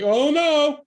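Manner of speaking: high, loud panicked gasp of "oh no"; mock shocked "oh no". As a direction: play it up as a shocked "oh no". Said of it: "act like you're like".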